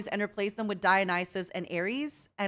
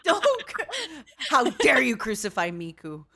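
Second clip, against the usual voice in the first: loud mock indignation through laughter; laughing outrage; 3 semitones higher, 5 dB louder than usual. Real, not acted. Friend scolding the collector over Miku, laughing outrage. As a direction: scold them while cracking up.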